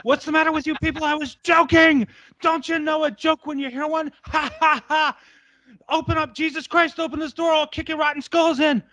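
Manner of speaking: high, frantic pleading yell; hammed-up locked-in panic ending in a cry; panicked yelling. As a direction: beg and rage to be let out. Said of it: "and he goes".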